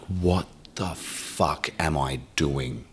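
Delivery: slow, soft, stunned to himself; stunned realization. Retold or imitated. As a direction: let the shock sink in slowly. Snapping out, daughter's text.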